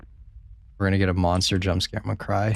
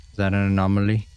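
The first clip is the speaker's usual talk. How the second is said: ordinary talk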